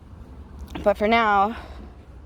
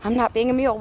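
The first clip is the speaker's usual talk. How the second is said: usual speaking voice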